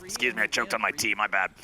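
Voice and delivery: raspy from coughing on tea; hoarse after choking. Real, not acted. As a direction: speak with a scratchy throat. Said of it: "I sound like a chain smoker now".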